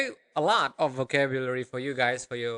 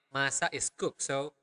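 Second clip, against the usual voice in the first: ordinary delivery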